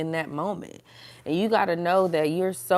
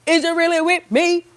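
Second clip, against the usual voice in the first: high, louder mock-probing voice; playful testing question; 10 semitones higher, 6 dB louder than usual. Voicing God testing believers, playfully insistent high question. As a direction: press the question, pitched way up. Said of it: "testing us to see".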